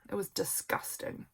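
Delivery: breathy whisper of "disgusting"; hushed, grossed-out whisper. Real, not acted. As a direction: whisper "It was disgusting" with a grimace. Repeats disgusting, second whispered.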